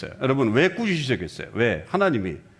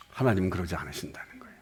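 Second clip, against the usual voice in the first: whisper; 9 semitones lower, 9 dB quieter, faster than usual. Real, not acted. Sermon aside.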